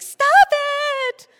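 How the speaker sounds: high, full-volume scream; acted-out shriek at mom; panicked yell. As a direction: yell it as a frantic teen. Retelling teen yelling at praying mom.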